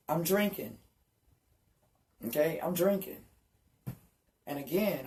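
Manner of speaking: ordinary talk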